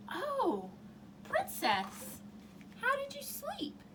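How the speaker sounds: high, sugary question; eager queen. Acted. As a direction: ask sweetly, dying to know. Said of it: "the queen said".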